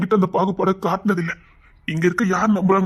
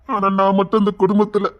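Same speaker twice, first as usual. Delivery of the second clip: normal talking voice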